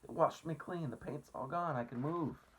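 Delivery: high excited bug voice; thrilled, loud and bouncing. A narrator's reading. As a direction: centipede delighted he can move. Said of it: "shouted the centipede excitedly".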